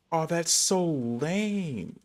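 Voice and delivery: ordinary talk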